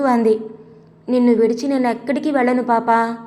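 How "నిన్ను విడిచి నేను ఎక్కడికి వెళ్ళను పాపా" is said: usual speaking voice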